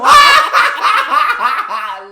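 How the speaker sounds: shouting through laughter; overjoyed, laughing it out; very loud, slow laughing shout. Real, not acted. Laughing at the movie memory.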